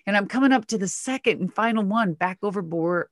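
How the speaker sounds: ordinary talk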